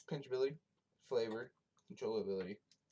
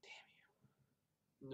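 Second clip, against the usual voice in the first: soft unvoiced whisper; 3 semitones higher, 15 dB quieter than usual. Real, not acted. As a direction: breathe it out as a whisper. Teasing over dropping the dip.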